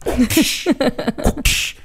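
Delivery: high airy whoosh sound; whooshing sound effect; cartoon whoosh. Shown as a demonstration.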